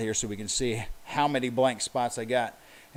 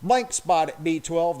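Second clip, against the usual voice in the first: usual speaking voice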